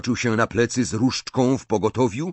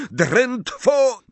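high-pitched cry; shouted spell; 12 semitones higher than usual. A narrator's reading. Harry casting at Snape, shouting. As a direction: yell a cut-off incantation. Harry casts mid-duel.

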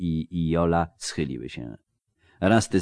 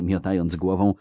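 ordinary delivery, nothing marked

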